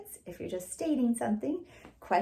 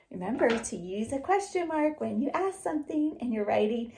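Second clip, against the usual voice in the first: usual speaking voice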